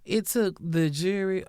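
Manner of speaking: usual speaking voice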